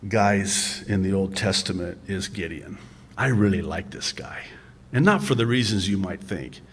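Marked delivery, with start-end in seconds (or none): 3.2-4.4 whisper. Real, not acted. Aside about favorite Bible figure.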